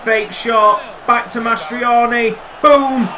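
ordinary delivery

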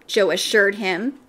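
usual speaking voice

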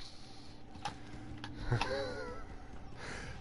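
wheezing laugh. Real, not acted.

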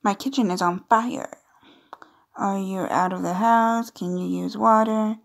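ordinary talk

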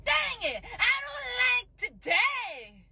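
high, slow whining wail; whiny kid tantrum. Acted. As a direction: drag out the sulky complaint like a spoiled child. Kid sulking over no snacks.

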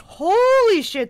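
loud, high, drawn-out shocked curse; scared exclamation. Real, not acted. Player startled by the monster, loud slow curse. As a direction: it just made you jump.

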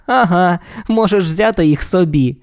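ordinary delivery

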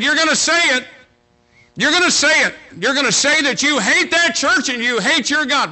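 put-on a drunken father bellowing at his daughter; furious shout. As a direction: yell it at her like a mean drunk.

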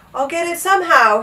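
high, loud yell. A narrator's reading. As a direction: yell with determination. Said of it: "he yelled".

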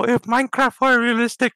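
ordinary talk

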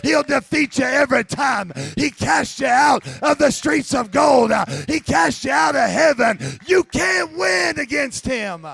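laugh, then shout the victory of Jesus; imp turned preacher. Pretend. Imp refuses, proclaims Jesus loudly.

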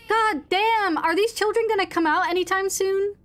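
real startled outburst; startled exclamation. Real, not acted. Startled by ghost children.

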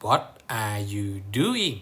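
"What are you doing?" read very slowly, word by word, falling on "doing"; teacher models the English title, slow and falling. Shown as a demonstration. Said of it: "มีชื่อบทว่า What".

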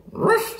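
high-pitched little dog yap; sharp, squeaky ruff; dog bark. A narrator's reading. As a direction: bark like an excited small dog. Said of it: "said Ebb".

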